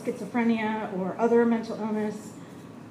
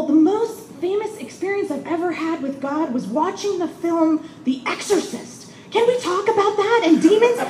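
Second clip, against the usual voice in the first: imitating an excited lady, much higher; eager lady; 8 semitones higher than usual. Patient quizzes the chaplain on demons, high eager imitation. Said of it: "And she said".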